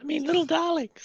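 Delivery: wicked stepmother. Pretend. Mocking sweet mother voice.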